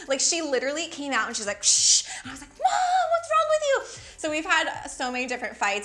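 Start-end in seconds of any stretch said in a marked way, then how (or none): 1.6-2.0 loud hissing "shh" of a spray can; spray noise. Retold or imitated. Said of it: "she sprayed me with Lysol". 2.7-3.8 loud, high-pitched shriek at mom; outraged teen yell. Retold or imitated. Retold teenage outburst at mom.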